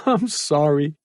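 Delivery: put-on high, hushed boy's apology; ashamed whisper. A narrator's reading. Shamed boy whispers sorry to his captor. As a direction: shrink into the apology. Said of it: "Malcolm whispered".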